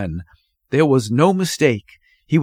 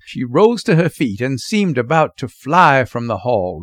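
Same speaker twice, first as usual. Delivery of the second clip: ordinary delivery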